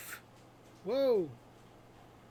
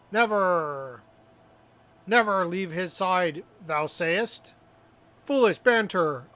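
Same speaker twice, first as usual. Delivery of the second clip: hammy stage actor; 3 dB louder than usual. Acted. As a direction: overact it like a drama student on stage. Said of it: "I have studied drama".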